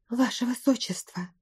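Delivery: soft, breath-only appeal; frightened whisper. A narrator's reading. Girl appealing to the prince, in a scared whisper. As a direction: whisper urgently to someone in fear. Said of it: "испуганно шепнула Каприза".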